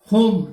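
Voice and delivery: होम loud, long o; say home. Shown as a demonstration. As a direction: call out होम clearly. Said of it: "होम में देख लीजिए". (होम का उच्चारण ज़ोर से)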